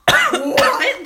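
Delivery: loud, high disbelieving what; shocked what. Retold or imitated. Told surgery took thirteen minutes, loud shocked what. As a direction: blurt it out in shock. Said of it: "I was like".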